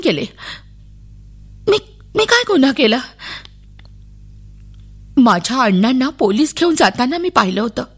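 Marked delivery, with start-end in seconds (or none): 1.7-3.0 put-on high, scared little girl's question; child's fearful plea, pitched up; frightened. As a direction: ask it like a terrified child. Frightened girl questioned by police.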